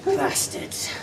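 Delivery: a high, soft, whispered Scots curse. Acted. Scottish warrior curses the English in a whisper. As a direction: hiss it high and breathless. Repeated curse, different takes.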